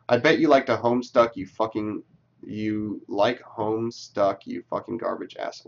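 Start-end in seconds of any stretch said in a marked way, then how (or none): none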